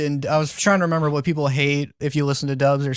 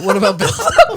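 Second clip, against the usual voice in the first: laughing; 6 semitones higher, 6 dB louder than usual. Real, not acted. Laughing while repeating the phrase.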